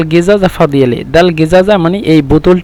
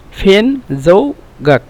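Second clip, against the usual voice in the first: ফেন জাওগাক drawn out slowly; slow, careful teacher demo; 3 semitones higher, much slower than usual. Shown as a demonstration. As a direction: spell out জাওগাক slowly for learners. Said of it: "জিমকে গয়েন বলে".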